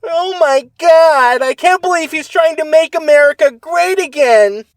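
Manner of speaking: high, loud, slowed whiny outcry; whiny liberal impression. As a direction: wail it like a shocked liberal. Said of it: "Spot on".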